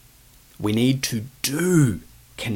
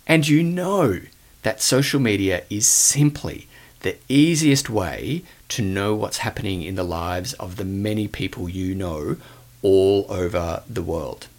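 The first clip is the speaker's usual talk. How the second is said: ordinary delivery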